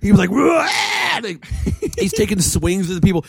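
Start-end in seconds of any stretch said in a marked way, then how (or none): 0.3-0.7 high-pitched mock howl; imitated crazed scream. Retelling his dragged-out friend foaming at the mouth, mock crazed scream. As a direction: imitate a raving man's wild yell. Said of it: "like Jack Nicholson at the very end".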